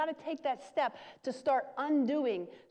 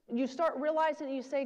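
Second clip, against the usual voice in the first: ordinary talk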